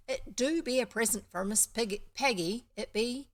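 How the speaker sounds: put-on lilting rural Somerset farm boy; slight Somerset accent. Farm boy presents a gift for Peggy, slight Somerset accent. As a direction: announce it importantly in a light Somerset accent. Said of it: "why we have this accent".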